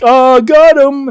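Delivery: high, loud, drawn-out mock crowing; hammed-up prankster triumph; gloating roommate. Roommate crowing over a prank fall, high drawn-out mockery. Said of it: "his roommate will be like".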